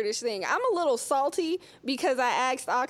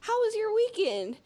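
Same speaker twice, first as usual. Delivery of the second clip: sweet stalling question; 8 semitones higher than usual. Retold or imitated. Student stalling teacher, higher voice.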